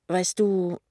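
hesitant, awkward opening; embarrassed. A narrator's reading. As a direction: start explaining with a touch of embarrassment. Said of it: "erklärt er verlegen". (leicht verlegen)

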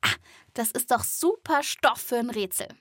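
ordinary delivery

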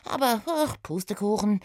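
hohe Mädchenstimme, am Ende enttäuscht; erzähl eifrig, dann enttäuscht; Oda, enttäuscht. Acted. (Oda erzählt Remus von der Spur, hoch und enttäuscht)

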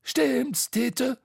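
high, quick clown voice; Bo's high voice. A narrator's reading. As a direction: politely dodge the ride. Bo voice held. (Bos hohe Stimme)

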